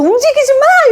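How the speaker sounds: high, loud mock shout; imitated father's yell. Father in the ambulance shouting at his son, mock yell. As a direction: bark it like an angry dad, hammed up. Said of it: "이렇게 했대".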